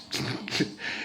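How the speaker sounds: a quiet, airless giggle escaping; laughing under the breath at the memory; breathless laugh. Real, not acted. Telling a funny story, he breaks into a breathy laugh. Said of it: "hard to tell me this without laughing".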